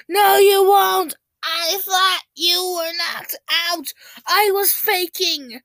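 high, loud, slow shouting. Acted. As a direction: yell it out in a squabble. Acted quarrel between voices.

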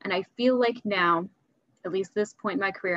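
ordinary delivery

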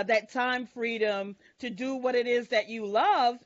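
usual speaking voice

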